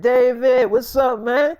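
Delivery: loud, high, friendly call across the set; excited greeting. Retold or imitated. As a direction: hail him like an old buddy.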